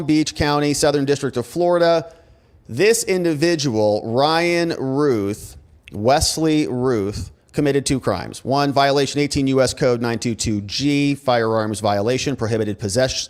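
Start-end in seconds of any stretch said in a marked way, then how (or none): none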